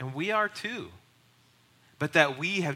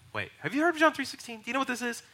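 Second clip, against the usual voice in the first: much higher-pitched astonished question; incredulous; 10 semitones higher, faster than usual. Retold or imitated. Passenger asking cab driver about John three sixteen, incredulous. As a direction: squeak it out in disbelief.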